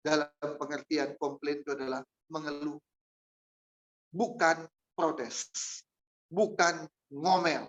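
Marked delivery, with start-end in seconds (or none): 4.1-7.7 each word slowed and leaned on; slow emphatic denial. Real, not acted. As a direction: spell out the difference, word by word. Preacher stresses the contrast.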